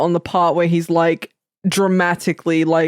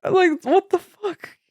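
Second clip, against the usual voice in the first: baffled high-pitched protest; mock-appalled, voice pitched up; incredulous reaction; 11 semitones higher than usual. Reacting in disbelief to the scene.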